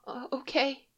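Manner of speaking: put-on high, soft, stammering girl voice; hesitant little girl. Frightened girl agrees to Leia, high, soft and stammering. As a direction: stammer a shy yes.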